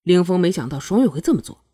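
ordinary delivery, nothing marked

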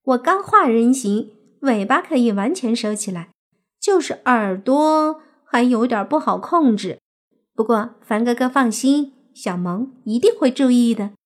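put-on high, cute girlish voice, eager to please; smiling fox-demon girl. A narrator's reading. Fox girl promises to hide her ears, smiling and high. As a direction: promise sweetly as a little fox spirit. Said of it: "小狐妖笑着点头说".

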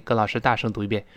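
ordinary delivery

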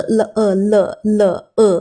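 "了呃了了呃" as a drawn-out neutral uh; "了呃了了呃" with a schwa, each sound separate; schwa demo. Shown as a demonstration. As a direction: sound out "了呃了了呃" slowly as luh, uh. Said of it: "发呃的音".

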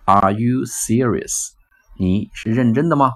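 very slow, each word drawn out; slow demo. Shown as a demonstration. Teacher modelling the phrase, very slowly. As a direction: spell it out slowly for learners. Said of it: "意思是什么呢".

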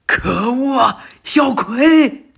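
father yelling for his daughter in danger; desperate shout. Acted. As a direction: scream her name as you leap. Naruto leaps to save daughter.

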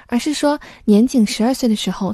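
normal talking voice

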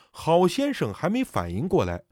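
ordinary delivery, nothing marked

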